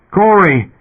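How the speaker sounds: high, loud call of "Corey!"; calling his partner over the radio. A narrator's reading. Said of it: "he called on the suitcom frequency".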